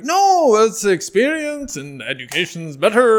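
high, loud, drawn-out backpedalling; hammed-up plea to change mom's mind; frantic pleading. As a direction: wail it slowly, desperate to persuade. Acts frantic pleading with mom.

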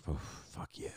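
exhaled, voiceless aside under the breath; soft, low whisper of contempt; whispered curse. Retold or imitated. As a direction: whisper it as a retold private reaction. Retold muttered reaction to heckler.

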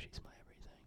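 very soft intimate whisper. Real, not acted. Boyfriend confides his devotion to the podcast audience, whispering. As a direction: whisper it like a sweet secret. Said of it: "Why are you whispering".

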